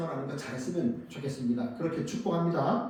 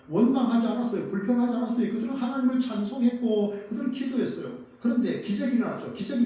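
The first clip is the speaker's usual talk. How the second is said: fervent preaching; 6 semitones higher, 4 dB louder than usual. Real, not acted. Preacher proclaims Paul's faith.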